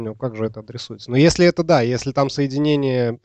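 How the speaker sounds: usual speaking voice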